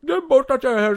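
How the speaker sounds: high, loud character voice; high-pitched, exasperated unseen guard; insistent voice in dark. Acted. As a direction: insist loudly in the put-on high voice. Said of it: "sa jag".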